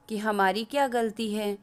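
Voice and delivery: ordinary delivery, nothing marked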